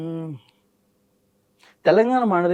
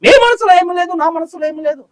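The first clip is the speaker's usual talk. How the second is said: much higher pitched film-hero delivery; hero impression; 10 semitones higher, 5 dB louder than usual. Mimic plays a film hero declaring to Savitri, high and dramatic. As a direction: throw the line like a filmy hero.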